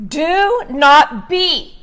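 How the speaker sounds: very slow, weighty scripture reading; measured preacher spacing out every word; deliberately slow. Shown as a demonstration. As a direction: read it out slowly, phrase by phrase. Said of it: "I'm gonna say it slow".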